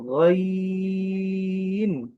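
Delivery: long sung গইন, throat sound held; teacher sings গইন slowly from the throat; drawn-out ghain. Shown as a demonstration. Arabic teacher drawing out the letter name. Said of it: "আমি আবার একটু বলে আসি".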